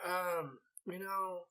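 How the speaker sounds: soft, hesitant acted-out excuse; hushed, evasive hedging; sheepish stalling. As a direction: stall quietly before a suspicious mother. Retold sheepish answer to mom.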